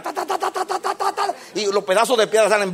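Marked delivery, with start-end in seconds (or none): 0.0-1.3 high, soft rapid ta ta ta; rattling stones. Acted. Preacher mimicking stones hit by the plow, high rapid ta. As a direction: tap out the stones striking the plow.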